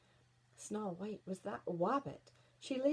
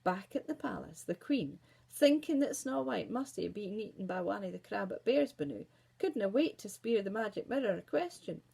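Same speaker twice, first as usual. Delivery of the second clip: ordinary delivery, nothing marked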